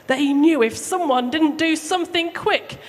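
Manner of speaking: ordinary delivery, nothing marked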